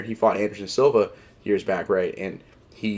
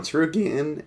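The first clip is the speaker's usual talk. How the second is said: voice crack; 6 semitones higher, slower than usual. Real, not acted. Podcaster redoing his take on Gamrot's wins, voice cracking. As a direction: voice cracks upward on Tsarukyan. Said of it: "voice crack there".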